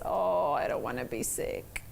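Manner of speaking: deep, soft, groaning complaint; a hammed-up sickly groan; mock whiny sick voice. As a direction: moan it like you're coming down with a cold. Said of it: "the first thing you say is".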